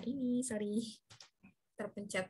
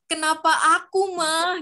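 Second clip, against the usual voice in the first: high, loud plaintive demo of "Kenapa aku, mah?"; sad whine; 5 semitones higher, 7 dB louder than usual. Shown as a demonstration. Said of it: "ditambahin deh". (rengekan sedih "Kenapa aku, mah?")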